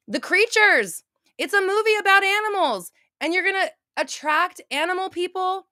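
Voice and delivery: high-pitched, worked-up indignant rant; real anger. Real, not acted. Fan outraged at animal deaths in a family movie, angry. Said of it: "That was real, true, like, anger".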